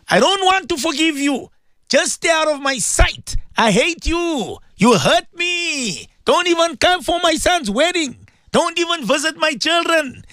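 high, hurt mock rant at a relative; imitating a bitter grudge. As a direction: play the unforgiving relative, wounded and cold. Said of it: "We speak like that".

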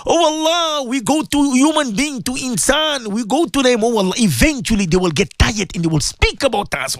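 ordinary talk, nothing marked

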